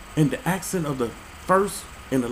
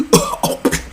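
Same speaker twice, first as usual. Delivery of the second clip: mock phlegmy throat-clearing; imitated hawking cough; 4 dB louder than usual. Comedian mimicking someone coughing up stuff, mock hacking.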